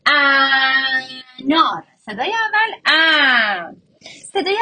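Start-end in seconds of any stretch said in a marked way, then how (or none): 0.0-1.8 "انار" very slow, opening "ا" held loud and long; stretched first sound. Shown as a demonstration. Teacher showing why the first sound is easy, stretched out. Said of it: "چون صدای اولش کشیده است". 2.9-3.7 "ا" held long and loud, gliding down; stretched sound. Shown as a demonstration. Teacher naming the first sound for children, stretched out. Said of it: "صدای اولش کشیده است".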